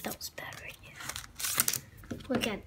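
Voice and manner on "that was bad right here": soft whispered aside; whispering. Real, not acted. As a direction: whisper it to yourself. Unvoiced aside.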